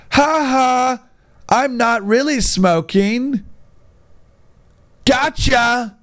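high, slow, gloating prankster voice; mock prankster. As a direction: crow it like a smug prank kid. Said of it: "It's like".

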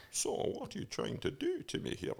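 a hint of a sneering villain; Eddie Izzard's Bond villain. Retelling the comedian's car sketch, softly mimicking the villain. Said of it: "he's doing this".

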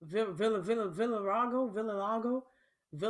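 "Villa, Villa, Villarago", groping for the word; stumbling mispronunciation. Real, not acted. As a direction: restart "Villa" haltingly, landing on a wrong "Villarago". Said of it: "let alone pronounce the shit".

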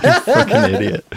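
high, laughing jab; mock her friend's stupidity while laughing; laughing mock insult.

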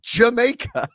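high, loud retort in a mock Jamaican accent; hammed-up Jamaican comeback. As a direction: imitate an indignant Jamaican comic. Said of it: "Your Jamaican accent is terrible".

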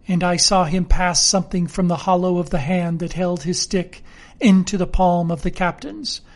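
plain reading voice

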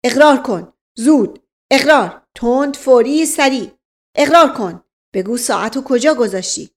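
loud, punched-out rapid-order commands; bullying interrogator. Acted. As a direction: demand a confession like an overbearing police inspector. Inspector barking an interrogation.

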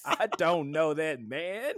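put-on high, slow childish denial; slow mock little girl. Repeating Pan's line to friends, slow childish mock voice. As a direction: drag it out like a stubborn kid. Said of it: "And she said".

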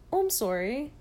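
light Canadian "sorry" with an OR sound; hint of Canadian vowels on "sorry"; imitated Canadian accent. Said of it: "you don't notice until they say things".

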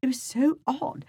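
high-pitched amateur British accent; hammed-up British reading voice. Shown as a demonstration. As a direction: put on a lilting, not very good British accent. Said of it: "I'm gonna read this in a British accent".

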